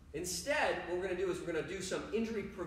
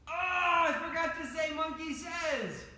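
mock dismay; 9 semitones higher than usual. Coach catching kids out in a game, mock dismay. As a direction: wail it high in pretend dismay.

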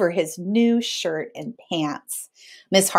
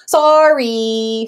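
grudging sorry; 4 semitones higher, 4 dB louder than usual. A narrator's reading. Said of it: "your 'I'm angry' voice to say 'I'm sorry'".

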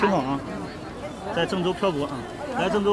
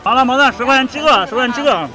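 loud vendor cry; street hawking call; 5 semitones higher, 5 dB louder than usual. Pretend. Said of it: "吆喝起来".

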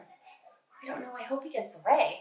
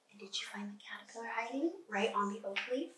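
soft unvoiced whisper, softer; 9 dB quieter than usual. Real, not acted. Teacher whispering to the children about the hidden caterpillar, whispered. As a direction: whisper the question like a secret.

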